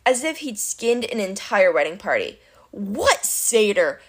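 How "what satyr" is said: slow, slow-witted monster; Cyclops voice. A narrator's reading. Puzzled Cyclops questions Clarice, slow monster voice. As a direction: drag the words like a puzzled giant. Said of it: "Polyphemus said".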